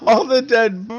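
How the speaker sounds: laughing. Real, not acted. Laughing at dead birds.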